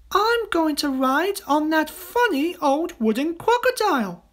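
put-on high little-girl voice; Jill. A narrator's reading. Little girl choosing her roundabout ride, high excited voice. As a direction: pipe up like an eager child.

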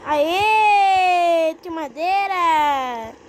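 loud joyful cry, stretched out; excited shout. Real, not acted. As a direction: shout it out in triumph. Acha madeira no jogo. (grito animado de vitória)

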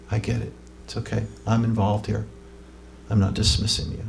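a quiet, compassionate confession of knowing her; tender Jesus. Acted. Voices Jesus speaking to the woman.

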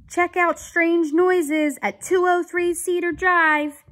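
high, loud radio dispatch; dispatcher's call. Acted.

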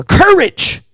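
sudden loud, high burst on COURAGE; shouted prayer word. Real, not acted. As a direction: punch COURAGE out at full voice.

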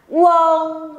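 QUÂN as "weng", one high, level, held syllable; joined-up glide. Shown as a demonstration. Teacher drilling students, joined-up QUÂN. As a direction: run U straight into the nasal ending of QUÂN. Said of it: "Đọc liền vào nào".

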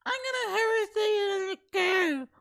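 high, drawn-out mock of the woman; mimicking her talk. Reactor mimics the pepper-spray woman, high mocking voice. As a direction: imitate her in a stretched falsetto. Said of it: "why is she talking like that".

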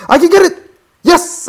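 high, loud burst of triumph; excited squeal. Real, not acted. Player urging himself on in game, excited squeal. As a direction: yelp with sudden hope.

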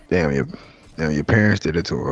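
voice-changer deep voice; deep gas voice. Real, not acted. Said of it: "why is your voice so deep?".

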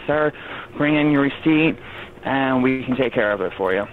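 usual speaking voice

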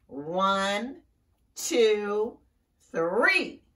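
loud, deliberate count, rising; warning count. Acted. Mama Frog counting for the creature to come out, loud measured count. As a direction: count it out firmly, each number bigger.

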